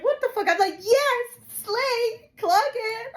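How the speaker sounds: high, loud hyped-up squeal; excited outburst. Retold or imitated. Retelling her reaction to riding in the boot, loud excited squeal. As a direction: build to a giddy, high-pitched cheer.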